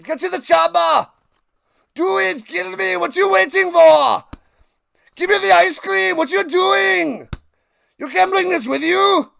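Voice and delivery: high, full-force action-hero yelling; hammed-up accented bellowing; Arnold Schwarzenegger impression. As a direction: shout frantic orders like an Austrian action star. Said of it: "Arnold Schwarzenegger to do a voiceover".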